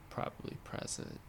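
unvoiced, fading whisper. Real, not acted. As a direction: let it fade to a whisper. Trailing off, thinking aloud.

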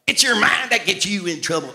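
put-on loud, high Southern preacher accent; Norval Hayes. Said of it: "I used to preach like Norval Hayes".

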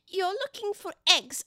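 high, loud, slow bird voice; accusing pigeon. Acted. Pigeon accusing Alice of egg hunting, high and loud. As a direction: press the charge, pitched well up.

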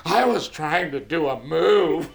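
put-on slow, sheepish kid voice, lightly done; a hint of a small boy explaining himself; lightly voiced little boy. Son's answer voiced by dad.

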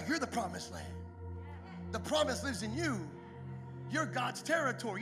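ordinary talk

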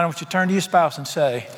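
ordinary delivery

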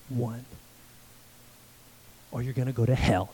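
deep, low, ominous and hard; menacing threat. Retold or imitated. Pastor to little boy, mock-menacing deep warning. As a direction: growl it like a stern judge passing sentence. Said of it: "I didn't say it like that".

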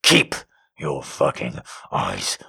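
low, soft growl of a dominant lover; growled command. A narrator's reading. Lover growls at her to keep eyes open, low growl. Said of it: "He growls at me".

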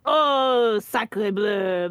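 hammed-up fake French accent, tossed off; mocking a fan's cheesy French; bad French accent. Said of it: "terrible attempt at a French accent".

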